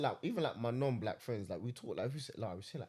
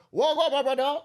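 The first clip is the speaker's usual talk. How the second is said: high, loud imitation of a coworker; high mock imitation; 11 semitones higher, 8 dB louder than usual. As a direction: squeak it out high and loud, mocking. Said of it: "He was like".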